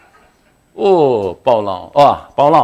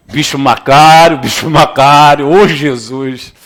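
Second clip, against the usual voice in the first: slow, louder calling voice; inner voice calling; 2 semitones lower, 5 dB louder, slower than usual. Acted. A hint of the inner voice calling, slow and louder. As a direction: call him like a conscience. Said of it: "vai ouvir essa voz".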